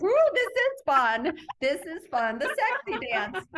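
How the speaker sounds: high, loud gleeful whoop; whooping with playful delight; excited whooping. Retold or imitated. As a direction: relive the thrill of dancing. Retelling her thrill while dancing.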